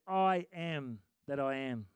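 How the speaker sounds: God speaking, slow and weighty; slow, low voice of God; slow, solemn divine voice. Acted. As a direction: draw out each word slowly. God's words quoted slowly.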